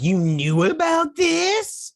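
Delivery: very high, loud mock shriek; imitated shrill, stretched-out accusation; outraged wife. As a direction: screech it slowly in hammed-up outrage. Acted quarrel, wife accuses husband.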